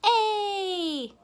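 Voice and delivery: high, loud class answer; imitated children's chorus, drawn out; kids calling out. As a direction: shout it like eager kindergartners. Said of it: "they would go".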